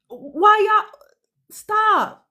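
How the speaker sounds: very high, exasperated plea; exasperated outburst. Real, not acted. Fan fed up with unserious contestants, exasperated outburst.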